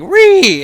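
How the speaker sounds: high, loud mocking "Ree!"; mock outraged screech. Mimicking the American guy told to move back in line, mock screech. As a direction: screech it like a parody tantrum.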